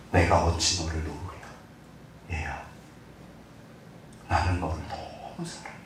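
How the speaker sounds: soft, low, slow fatherly whisper; God gently reassuring his beloved child, softly; tender God voice. Acted. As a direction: speak like God comforting a child.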